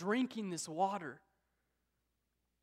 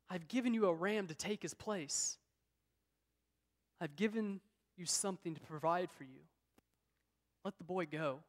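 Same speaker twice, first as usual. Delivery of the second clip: a hint of soft, reassuring God, dropping softer line by line; gentle voice of God; 4 dB quieter than usual. God tells Abraham to let the boy go, softly and calmly. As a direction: ease him down gently after the shout.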